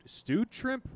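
stewed shrimp pitched high, rising; stewed shrimp as an incredulous question; disbelief. Real, not acted. What the fuck?.

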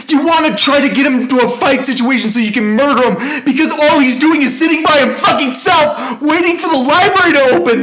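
real heated outburst that keeps climbing; indignant rant. Real, not acted. Accusing rant builds in force.

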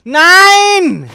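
high, loud drawn-out scream; anguished scream. Real, not acted. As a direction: wail the no in despair. Character dies in game.

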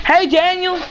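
high-pitched call to a player. Real, not acted. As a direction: call out a name, pitched well up. Calls out to another player.

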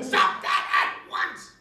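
very high, loud, slow shriek; witch screaming. A narrator's reading. Witch screaming at the children on her house. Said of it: "she screamed again".

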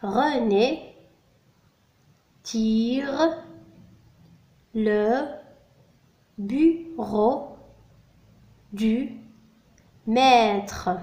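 slow word-by-word reading with long pauses; measured French dictation for pupils; repeated dictation. Shown as a demonstration. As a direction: dictate each word separately and carefully. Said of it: "أعيد".

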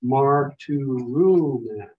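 "Marturumen" said slowly, syllable by syllable, falling at the end; slow model word. Shown as a demonstration. Teacher reads word first for students to repeat, slow. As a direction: stretch out "Marturumen" for the class to copy. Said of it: "I'm gonna read the word first and then you read it after me".

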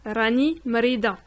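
ordinary talk, nothing marked

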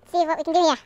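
somewhat loud, lively remark; lively but controlled. Real, not acted. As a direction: say it up and projected, short of shouting. First, milder take.

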